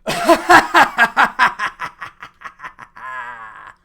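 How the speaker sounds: loud, high villain cackle; evil laugh. Pretend. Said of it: "and then evil laugh".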